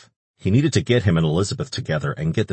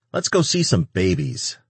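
bright, much higher eager pitch; 8 semitones higher than usual. Acted. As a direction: pitch it up with glee. Eager angel suggestion.

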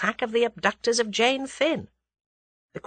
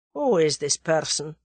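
Russian conspirator voice; 3 semitones lower than usual. A narrator's reading. Russian presses German.

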